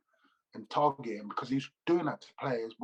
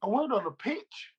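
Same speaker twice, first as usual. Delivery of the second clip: much higher, meek little voice; meekly pleading, higher; soft-voiced teammate; 12 semitones higher, 3 dB louder than usual. Teammate meekly answering the angry manager, higher and soft. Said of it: "He, he was soft".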